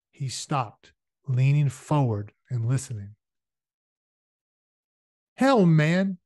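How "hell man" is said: high, loud grumble; grumbling. A narrator's reading. Henry Shipstead objecting to Shane's rush, grumbling. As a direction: complain loudly in a raised voice. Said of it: "Henry Shipstead was grumbling".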